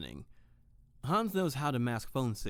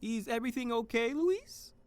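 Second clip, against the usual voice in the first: cheerful Hans; 12 semitones higher than usual. Acted. Hans calling out to Tiffany that the toast is ready, high and cheerful. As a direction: bounce it high like a jolly host.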